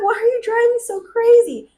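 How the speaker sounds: high-pitched imitation of drunken protest; mock indignant complaint. Retold complaint to driving boyfriend.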